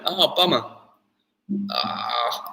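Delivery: ordinary talk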